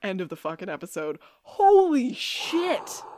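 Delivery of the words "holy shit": high, slow, louder holy shit; excited exclaim. Real, not acted. Fan stunned by the episode ending, slow excited exclaim. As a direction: react to the cliffhanger ending.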